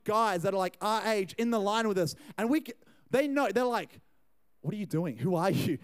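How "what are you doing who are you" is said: low-voiced interrogation, lightly imitated; mock suspicious challenge. Young guys questioning a crasher in the line, lightly mocked suspicion. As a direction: question the stranger in a lower voice.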